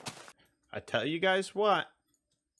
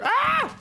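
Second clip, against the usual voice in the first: startled yelp. Real, not acted. Sudden attack in game.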